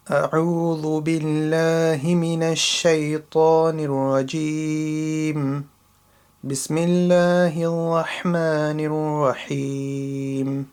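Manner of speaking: measured, melodic Quranic recitation. Shown as a demonstration. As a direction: recite it with full tajweed, letting each phrase ring. Recitation one more time.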